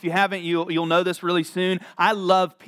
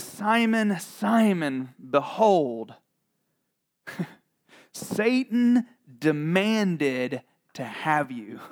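slow, portentous reading with long pauses; dramatic pauses between phrases; dramatic Bible reading; much slower than usual. Acted. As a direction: stretch it out for suspense. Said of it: "there's a dramatic pause right there".

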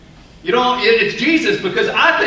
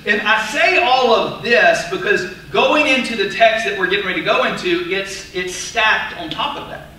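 ordinary talk

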